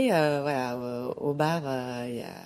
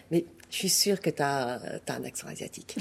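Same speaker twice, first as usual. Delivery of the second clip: petit sourire en coin; 4 semitones lower, 5 dB quieter than usual. Retold or imitated. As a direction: imitate a smirking insistence, dropping away softly. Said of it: "avec un petit sourire en coin comme ça". (insistance en coin, finie en chuchotant)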